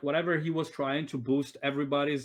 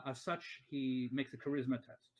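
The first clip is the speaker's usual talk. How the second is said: normal talking voice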